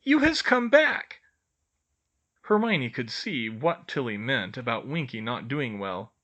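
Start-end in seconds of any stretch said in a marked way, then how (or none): 0.0-1.2 high elf voice, a touch of sorrow; sad elf. A narrator's reading. Winky, drunk and sad.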